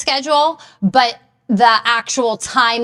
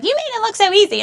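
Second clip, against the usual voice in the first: much higher-pitched mimicking voice; light, high-pitched quoted admiration; imitated gym clients; 12 semitones higher, much faster than usual. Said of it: "And they'll be like".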